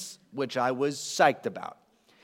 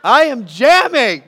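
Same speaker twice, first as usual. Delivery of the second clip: loud, higher, slow proud jamming; excited boast; 7 semitones higher, 13 dB louder, slower than usual. Retold or imitated. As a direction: belt out your pride in jamming. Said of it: "I'm jamming".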